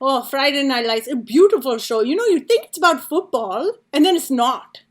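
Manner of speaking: effusive mom impression, big and emphatic; imitating gushing mom. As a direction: play mom proudly recommending her favorite show. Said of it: "She's like".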